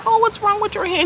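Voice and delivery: high, loud, sugary mock concern; mock caring housewife. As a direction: fake sweetness, mocking her. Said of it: "she's playing Betty Crocker".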